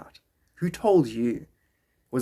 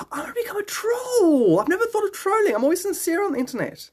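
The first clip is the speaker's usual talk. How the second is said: gasping, very high-pitched theatrical wail; gasp, then squeal the revelation; mock astonishment. Mock revelation of becoming a troll.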